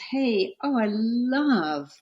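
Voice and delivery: acted enthusiastic compliment; gushing praise. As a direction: gush admiringly, drawing out LOVE. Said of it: "If you're never praised and someone says".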